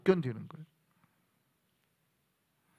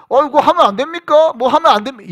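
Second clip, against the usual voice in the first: high, loud recruit questioning; loud eager recruit; 8 semitones higher, 9 dB louder than usual. Retold or imitated. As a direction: shout the question to the sergeant. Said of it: "이런 말을 할 적이 없어요". (크고 높게 묻는 훈련병)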